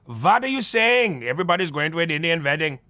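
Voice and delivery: light Indian accent with v for w. Shown as a demonstration. Asked to do Indian, he puts on an Indian accent. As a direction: swaps every w for a v, Indian style. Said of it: "Can you do Indian?".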